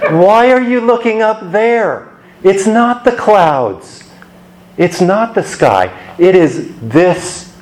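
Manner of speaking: mock scolding angel, drawing out same Jesus; angels speaking. Angels chiding the disciples gazing up at the sky, high and pointed. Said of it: "And what do the angels say?".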